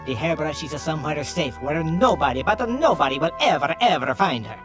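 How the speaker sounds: lightly hammed Italian accent telling a legend; Italian barber accent. Barbers reveal the hairbrush secret to the detective, stage Italian.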